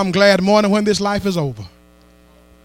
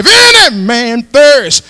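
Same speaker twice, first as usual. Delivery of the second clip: normal talking voice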